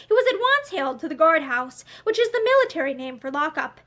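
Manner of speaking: ordinary delivery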